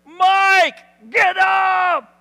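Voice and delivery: high, full-volume shout; loud command. Acted. Mourner orders Mike up from his casket, shouted command. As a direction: yell it as if waking the dead.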